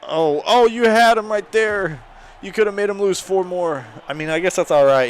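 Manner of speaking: loud, high exasperated shouting; frustrated yelling. Real, not acted. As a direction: yell at the screen in disbelief. Yelling at player on screen.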